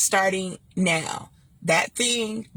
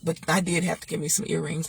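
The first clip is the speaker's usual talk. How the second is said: usual speaking voice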